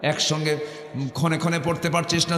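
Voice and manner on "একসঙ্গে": ordinary talk, nothing marked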